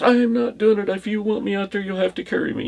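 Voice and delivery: high, stubborn imitation of a woman refusing; a hammed-up stubborn protester; defiant woman. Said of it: "she says".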